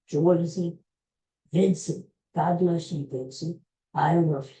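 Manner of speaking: ordinary talk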